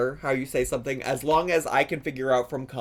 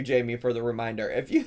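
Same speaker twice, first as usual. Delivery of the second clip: ordinary talk, nothing marked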